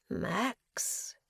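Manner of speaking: breathy, hushed greeting; breathed. A narrator's reading. Prisoner greets an old acquaintance, breathed. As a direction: breathe the name out softly. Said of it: "he breathed".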